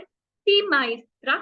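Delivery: सी माइस्ट्रा said slowly and clearly with a rise; slow, clear model reply सी माइस्ट्रा for students to repeat; model answer. Shown as a demonstration. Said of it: "आपको बोलना है सी मतलब yes". (सी माइस्ट्रा धीमे, साफ़ बोलकर दोहराने के लिए)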